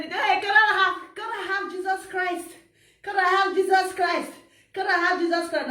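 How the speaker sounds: high-pitched mimicked pleading; mocking plea. Mimics people begging for Jesus Christ.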